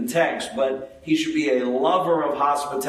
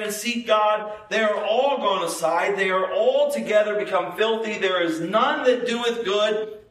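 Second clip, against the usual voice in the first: raised, ringing pitch for Bible verses; scripture recitation; 5 semitones higher than usual. Acted. Preacher quoting Psalm fourteen to congregation, scripture recitation. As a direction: recite the psalm high and steady, building to the end.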